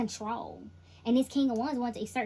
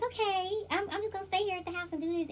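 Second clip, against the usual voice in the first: high, fast, breezy fake innocence; mock innocent partner; 9 semitones higher than usual. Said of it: "they're like".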